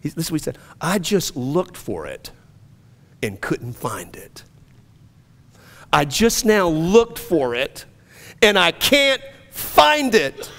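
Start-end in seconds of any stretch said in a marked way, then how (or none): 0.8-4.5 slow, soft, almost whispered; quiet wonder, barely breathing it; hushed disbelief. Retold or imitated. Man quietly discovering freedom. 5.9-10.5 rising joy. Retold or imitated. Same words, now rising joy.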